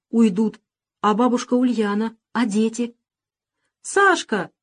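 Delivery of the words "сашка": put-on high child's call near tears; tearful little girl. A narrator's reading. Little girl calls Sasha, close to tears. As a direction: call him back, about to sob. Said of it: "позвала Маринка и всхлипнула".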